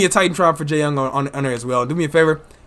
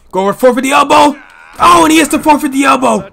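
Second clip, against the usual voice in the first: loud, high excited shout; shouted call; 10 semitones higher, 9 dB louder than usual. Acted. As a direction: yell the big move. Big move called out.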